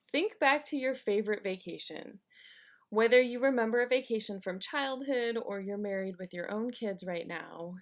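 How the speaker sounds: usual speaking voice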